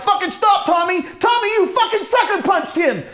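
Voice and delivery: outraged yelling at an attacker, pitch way up; frantic shout. Acted. Brother yells at Tommy as he kicks Les, frantic shout. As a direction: scream it high and hard. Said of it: "I shout".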